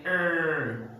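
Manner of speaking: long, slow "er" with strong R; emphasize the R in "er"; stressed American R. Shown as a demonstration. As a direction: stretch the R of "er". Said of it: "They emphasize in the letter R".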